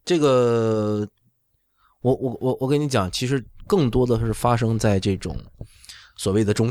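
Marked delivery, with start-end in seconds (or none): none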